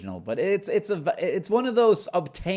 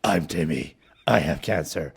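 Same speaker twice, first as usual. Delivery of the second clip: deep, low gravelly Batman growl; 7 semitones lower, 4 dB louder than usual. Pretend. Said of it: "Was that Batman suddenly?".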